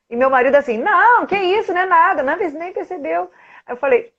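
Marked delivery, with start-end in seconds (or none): 0.8-3.3 higher-pitched imitation of the husband waving it away; mock-casual husband covering up; husband brushing it off.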